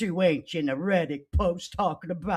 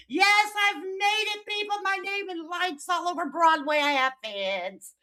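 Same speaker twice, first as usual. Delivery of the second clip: mock triumph; 9 semitones higher than usual. Mock triumph about having fans.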